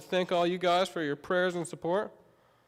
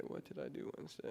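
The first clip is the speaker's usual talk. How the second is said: muttered to self; 17 dB quieter, faster than usual. Real, not acted. Muttering to himself while recalling.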